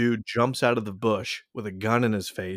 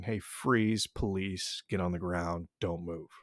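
recited police warning; 3 semitones lower, 8 dB quieter, slower than usual. Retold or imitated.

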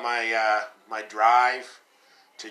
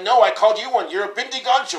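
high-pitched mimicked Indian accent; thick Indian accent; 10 semitones higher, 6 dB louder, faster than usual. Caller and prankster trading insults, mock Indian accent. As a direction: argue back in an exaggerated Indian accent. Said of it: "thick Indian accent".

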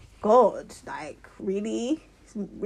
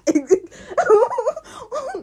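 loud high burst of laughter; real laughter; 9 dB louder than usual. Real, not acted. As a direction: crack up at the meme. Laughs at Spider-Man meme.

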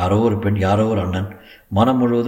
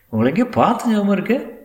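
drawled; 11 semitones higher than usual. A narrator's reading. Lawyer to the crying woman he thinks he knows, drawled. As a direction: stretch it out in a high, teasing lilt. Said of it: "என்று இழுத்தான்".

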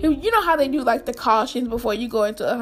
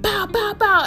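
higher imitated pow pow pow gunshots; gunshot noises; 7 semitones higher than usual.

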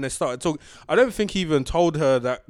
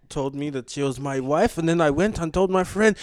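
usual speaking voice

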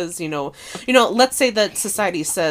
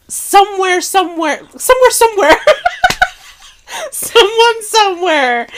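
dramatic buildup; 11 semitones higher, 6 dB louder than usual. Real, not acted.

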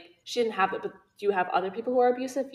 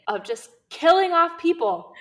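exasperated outburst; 9 semitones higher, 7 dB louder than usual. Real, not acted. Venting anger at the author.

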